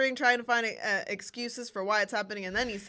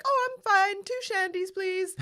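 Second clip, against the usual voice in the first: put-on high chirpy old lady; 9 semitones higher than usual. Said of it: "she's like".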